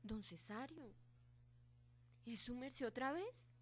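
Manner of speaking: put-on low, hushed frightened girl; terrified whisper. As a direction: whisper it in dread.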